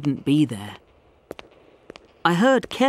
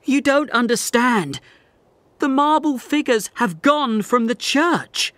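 frantic pleading; 8 semitones higher, 3 dB louder than usual. Acted. Panicked man pleads with doctor.

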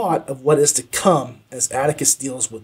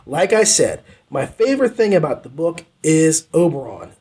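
usual speaking voice

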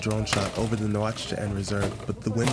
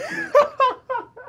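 high, loud burst of laughter; laughing; 6 dB louder than usual. Real, not acted. Storyteller breaks up recalling the stranger, laughing out loud. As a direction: crack up mid-story.

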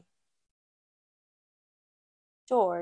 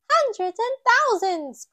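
high, loud amazed cry; a little girl amazed at the pile; Peppa crying out; 13 semitones higher, 9 dB louder than usual. A narrator's reading. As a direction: Peppa watching batteries pour out, high and loud. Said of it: "cries Peppa".

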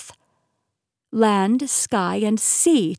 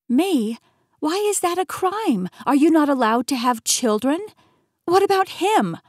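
shocked indignation; 7 semitones higher, 4 dB louder than usual. Acted. Protagonist accuses, shocked and indignant.

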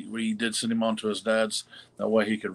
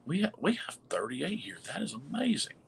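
whispering; 7 semitones higher, 7 dB quieter than usual. Real, not acted. Marvelling at viewer count.

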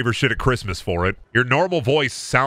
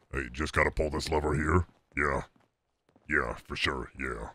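very low, soft character voice; Moltar; 10 semitones lower, 10 dB quieter than usual. Acted. Streamer approximating an effected cartoon voice, very low and quiet. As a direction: sink deep and quiet. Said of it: "but it's more like".

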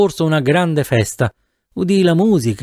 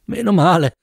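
ordinary delivery, nothing marked

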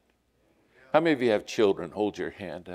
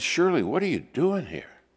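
startled; 7 semitones higher than usual. Retold or imitated. Startled finding secretary at night.

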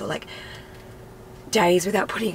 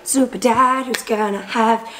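playful teasing; 4 semitones higher, 6 dB louder than usual. Real, not acted.

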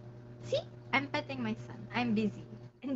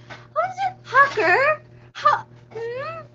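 squeaky questions; 11 semitones higher, 4 dB louder, slower than usual. Real, not acted.

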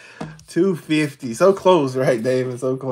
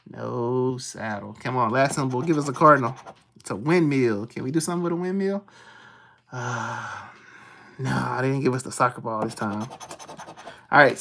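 ordinary talk

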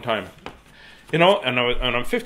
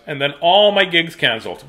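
usual speaking voice

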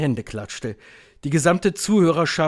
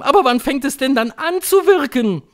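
high, loud eager cry; raised crowd yell; shouting crowd; 13 semitones higher, 8 dB louder than usual. A narrator's reading. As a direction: shout back like an adoring audience. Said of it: "schrie das Publikum".

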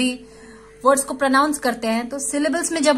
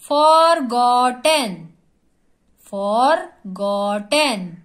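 Forgotten in three slow, stretched beats; syllable by syllable; 4 dB louder, slower than usual. Shown as a demonstration. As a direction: sound out forgotten loudly for counting syllables. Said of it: "एक एक word हमको जोर से बोलना है".

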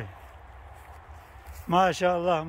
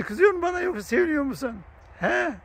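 coaxing the animal; 8 semitones higher than usual. Real, not acted. Asking the animal if it's angry.

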